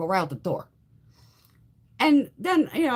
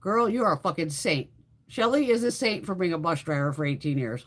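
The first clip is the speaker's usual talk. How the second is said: ordinary delivery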